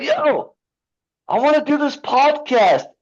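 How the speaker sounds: excited imitation. Retelling a friend's call to the podcast host, high and eager. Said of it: "you're like".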